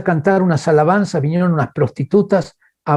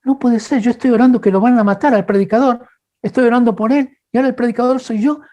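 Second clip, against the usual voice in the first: panicked disbelief; 6 semitones higher than usual. Retold or imitated. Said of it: "Yo dije".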